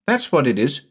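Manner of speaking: lightly voiced young son; little boy Jamie. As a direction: ask like a small boy puzzled.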